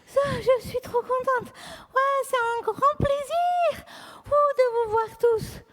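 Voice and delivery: slow, high-ish cheerful greeting; happy puppet character. Acted. Charlotte greeting the children, slow and delighted. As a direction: beam at the children.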